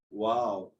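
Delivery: usual speaking voice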